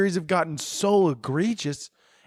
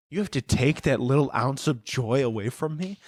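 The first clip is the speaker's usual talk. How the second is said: ordinary talk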